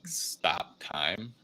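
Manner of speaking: ordinary talk, nothing marked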